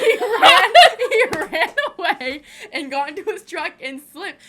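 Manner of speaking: laughing loudly through the words; can barely get it out for laughing. Real, not acted. Sister telling how the boy slipped, laughing hard. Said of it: "And it was so funny".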